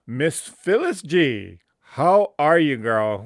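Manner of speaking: light up, she's back; excited greeting. Real, not acted.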